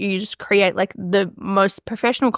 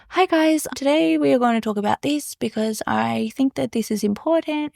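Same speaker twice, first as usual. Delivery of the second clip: out-of-character podcast host; 3 semitones higher than usual. Pretend. Podcaster opening an episode unlike herself, measured and unhurried. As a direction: put on a careful, unhurried presenter voice. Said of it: "normally I'm moving a million miles an hour".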